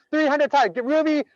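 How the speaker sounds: loud, high, drawn-out game show host announcing the winner; game show winner call. Pretend. Host hypes a chat answer as the game show winner, loud and high. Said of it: "Price is Right".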